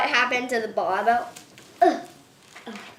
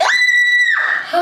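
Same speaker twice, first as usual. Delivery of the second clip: loud high deliberate scream; scream on purpose; 4 dB louder than usual. Real, not acted. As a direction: let one more scream out. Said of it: "One more scream out".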